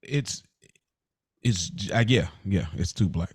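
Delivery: ordinary delivery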